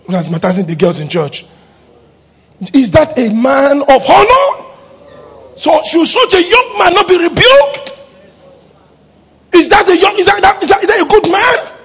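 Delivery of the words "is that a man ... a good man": loud, high demanding questions; outraged high questions building; indignant rhetorical questions. Real, not acted. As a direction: challenge the congregation. Preacher's rising rhetorical outrage.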